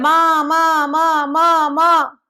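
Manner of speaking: put-on a child crying out for his mother. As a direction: call for mummy like a whining toddler. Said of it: "फिर रो रहा है".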